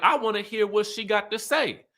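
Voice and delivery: high teasing voice of a castmate; imitating Tanika. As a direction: needle her with a sweet high voice. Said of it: "So then Tanika's like".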